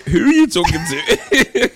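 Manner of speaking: laughing question, high and loud. Real, not acted. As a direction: blurts it out through laughter. Laughing at friend's dramatic declaration.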